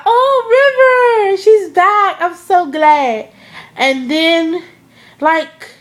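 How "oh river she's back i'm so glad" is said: high, loud, teary-sounding cry of joy; overjoyed squeal. Retold or imitated. Fan reliving River's return, shrieking with teary joy. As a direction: gush with relief as if you might cry.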